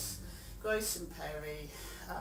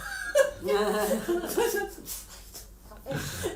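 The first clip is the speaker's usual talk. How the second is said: high burst of laughter; 14 semitones higher, 9 dB louder than usual. Real, not acted.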